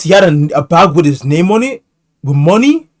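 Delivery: can't believe what he's hearing; high, loud disbelieving question; shocked disbelief. Real, not acted. Shocked reaction to book story.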